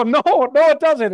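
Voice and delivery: high, loud, insistent no, no; missionary correcting the young man, high and loud; emphatic correction. Retold or imitated. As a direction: cut him off and set him straight. Speaker firmly corrects the young man.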